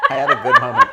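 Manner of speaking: high, laughing squeal. Real, not acted. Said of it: "It was too good".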